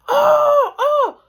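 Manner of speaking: high loud cry of pain, oh oh. Retold or imitated. Hit by a BB, crying out in pain. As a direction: yelp out as if just shot. Shot by BB gun, reacting.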